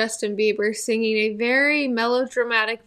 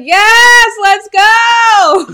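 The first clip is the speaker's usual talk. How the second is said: excited shout; 9 semitones higher, 14 dB louder, much slower than usual. Retold or imitated. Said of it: "He's like".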